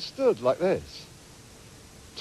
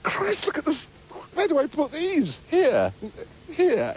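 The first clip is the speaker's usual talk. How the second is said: flustered inner voice; 8 semitones higher than usual. Retold or imitated. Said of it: "It's this".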